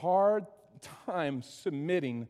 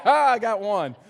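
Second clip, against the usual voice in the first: laughing; 3 semitones higher, 9 dB louder than usual. Real, not acted. Laughing at the lone amen.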